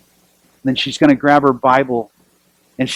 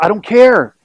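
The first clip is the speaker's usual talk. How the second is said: a retold yell, higher and louder; mock yell; 6 semitones higher, 4 dB louder than usual. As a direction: shout it at the TV, half in fun. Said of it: "And I'm screaming".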